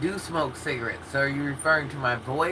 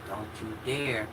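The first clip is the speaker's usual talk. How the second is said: low, soft, menacing; hushed threat; low stern warning; 7 semitones lower, 9 dB quieter than usual. Retold or imitated. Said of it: "my dad said".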